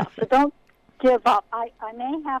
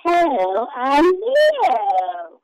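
very slow, drawn-out critter voice; harsh creature voice; 5 semitones higher, 7 dB louder, much slower than usual. Acted. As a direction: loom out of the dark. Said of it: "The voice cruelled her ample ears".

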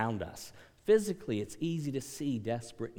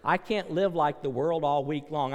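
ordinary talk

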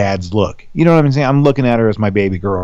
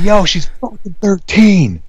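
warning yell; 8 semitones higher, slower than usual. Retold or imitated. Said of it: "I was like".

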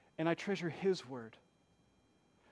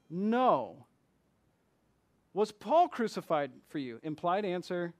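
ordinary talk, nothing marked

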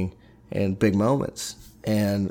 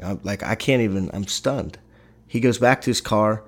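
usual speaking voice

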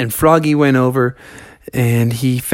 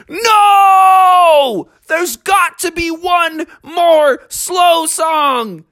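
desperate shout; 13 semitones higher, much slower than usual. A narrator's reading. Froggy protesting the DJ ending the night, crying shout. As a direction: howl it out, very high.

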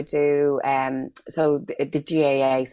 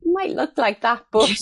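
higher and slow, teasing self-deprecation; wry modest reply; 9 semitones higher, 4 dB louder than usual. Retold or imitated. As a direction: play it down with a knowing lilt. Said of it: "And I go".

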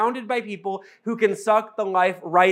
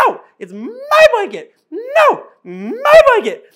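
very high, loud, comic squeal of a dad teasing his dog; high-pitched funny dad voice; 9 dB louder, slower than usual. Retold or imitated. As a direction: go way up into a silly falsetto and hold it. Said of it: "I went high-pitched, funny dad voice".

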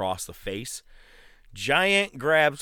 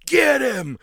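much higher, louder barked command; sudden yell; 7 dB louder than usual. Retold or imitated. Hogan ordering the NWO to attack, sudden yell. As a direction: snap from sweet to shouting the order. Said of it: "looks up and goes".

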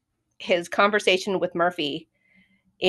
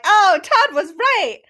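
surprised realization; 10 semitones higher, 4 dB louder, slower than usual. Retold or imitated. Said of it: "I was like".

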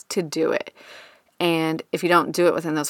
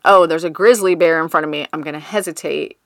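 mock alarm; 7 dB louder than usual. Voicing the brain's danger alarm.

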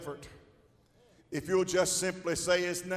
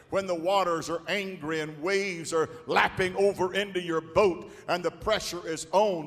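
ordinary talk, nothing marked

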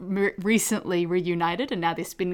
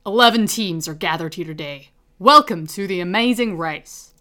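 Phil Keoghan impression; 8 dB louder than usual. Shown as a demonstration. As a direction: boom the welcome like a reality show host. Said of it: "little example".

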